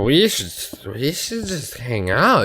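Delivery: put-on drunk. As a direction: drawl it out like a wasted lover. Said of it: "I was so gone".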